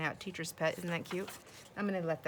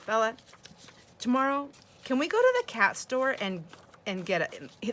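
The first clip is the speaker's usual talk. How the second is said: put-on higher, loud, earnest little-boy request; grandson's serious voice; 6 semitones higher, 7 dB louder than usual. Grandson asks Bella about the cat store, serious voice. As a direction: imitate a small grandson asking earnestly, pitched up. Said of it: "this is his serious voice now".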